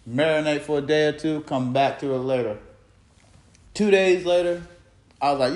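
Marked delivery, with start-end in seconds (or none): none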